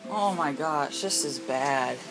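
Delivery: ordinary talk, nothing marked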